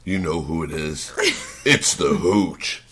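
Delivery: low, soft, slow mock menace; prank-caller menace. Prank caller names himself to the angry victim, mock menacing. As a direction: play the ominous caller, dropping low and drawing it out.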